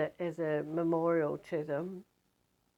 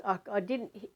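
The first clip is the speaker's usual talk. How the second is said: ordinary delivery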